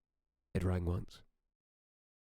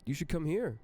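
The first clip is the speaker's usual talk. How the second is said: Amy laughing; 9 semitones higher than usual. A narrator's reading. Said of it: "she said, laughing".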